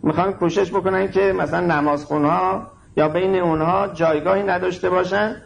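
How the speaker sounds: ordinary talk, nothing marked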